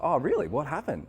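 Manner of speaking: retold delighted curiosity; eager interest. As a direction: perk up, keen to hear the news. Acts own eager reply on phone.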